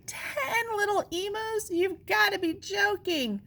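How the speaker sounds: stunned high-pitched protest; choking disbelief. Acted. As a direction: squeak it out like he's choking. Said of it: "He seemed to be choking".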